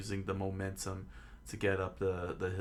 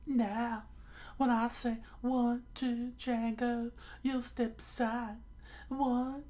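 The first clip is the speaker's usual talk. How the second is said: very high falsetto pirate; high cartoon villain; hypnotist pirate captain; slower than usual. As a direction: count it off in a squeaky high voice.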